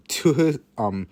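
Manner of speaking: usual speaking voice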